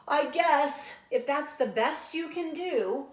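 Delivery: breathless. Acted. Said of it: "she gasped".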